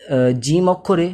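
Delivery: slow, stretched jim; drawn-out jim. Shown as a demonstration. Teacher stretching the letter jim slowly. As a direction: drag the letter name out. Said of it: "টান দিয়ে আমাকে জিমটা বলতে হবে".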